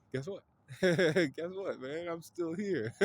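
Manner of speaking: high, loud cackling taunt; laughing taunt. Retold or imitated. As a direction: gloat with a mad laugh. Gojo taunting Toji back from dead.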